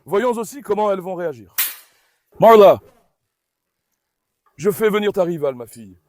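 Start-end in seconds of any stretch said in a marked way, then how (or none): 2.4-2.8 loud shout of the name; calling out. Real, not acted. Owner calling Marla to attention, loud shout. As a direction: call the dog sharply.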